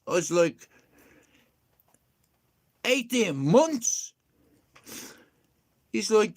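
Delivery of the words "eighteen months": slow, drawn-out disbelief; stunned repeat. Retold or imitated. Repeating the mate's answer back, slow and stunned. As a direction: stretch it out in shock.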